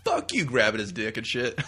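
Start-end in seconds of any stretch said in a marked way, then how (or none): 0.0-0.4 shouting back at the audience, hammed up; mock yell. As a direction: yell it at a booing crowd. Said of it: "And Zeus is like".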